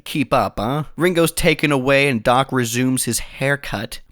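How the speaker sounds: ordinary talk, nothing marked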